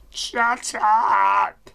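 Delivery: drawn-out holler; yelled rebuke. Real, not acted. Player to people shouting nearby, drawn-out yell. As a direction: yell it to silence the noise.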